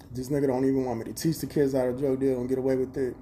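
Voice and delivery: ordinary talk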